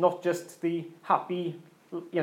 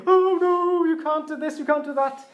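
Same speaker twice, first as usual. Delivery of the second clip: much higher, louder panicky squeak; timid Fear; 9 semitones higher, 4 dB louder than usual. Retold or imitated. Preacher voicing Fear from the film, high timid panic. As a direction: squeal it like a timid worrier pulling back. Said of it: "who's very timid and very".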